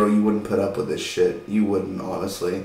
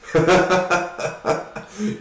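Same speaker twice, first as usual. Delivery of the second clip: laugh; 7 semitones higher than usual. Real, not acted. Laughs after the acted shriek.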